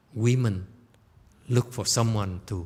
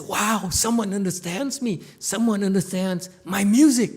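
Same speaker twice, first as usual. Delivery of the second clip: high, loud joyful cry; Yu Bo Ya thrilled someone gets his music, high and loud; overjoyed; 12 semitones higher, 8 dB louder than usual. Acted. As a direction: burst out in relief and joy. Musician overjoyed at being understood.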